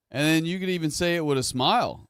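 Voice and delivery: ordinary delivery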